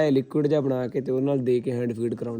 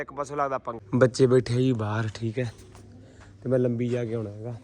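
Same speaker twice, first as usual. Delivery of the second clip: ordinary delivery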